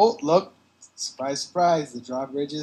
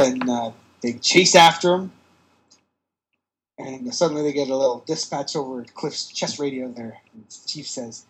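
normal talking voice

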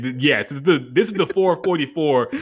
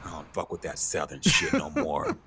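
a hint of a mock New York attitude, dropping low and soft; half-hearted mock New Yorker, muttered low; mock New York snob; 6 semitones lower, 4 dB quieter than usual. Playing a hip hop purist to tease his friend, mock New York attitude. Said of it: "I can't do a New York accent".

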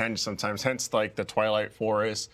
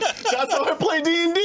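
very high proud claim; excited; 3 dB louder than usual. Retold or imitated. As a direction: crow it out. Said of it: "he was just like".

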